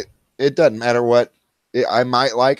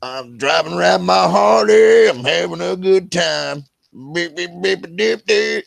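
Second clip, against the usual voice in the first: mock biker voice; 7 semitones higher than usual. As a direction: play a happy Harley rider. Mocking a biker's vibe.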